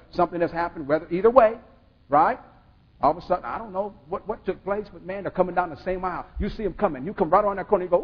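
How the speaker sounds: ordinary delivery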